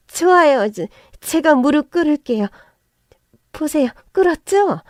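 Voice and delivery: high, loud, desperate begging; frantic pleading. Acted. Mother-in-law kneeling frantically before the narrator, high desperate plea. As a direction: beg wildly while dropping to her knees.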